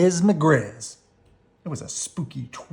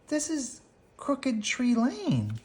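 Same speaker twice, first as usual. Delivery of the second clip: uneasy Sister; 12 semitones higher, 3 dB quieter, slower than usual. A narrator's reading. Sister noticing the lane.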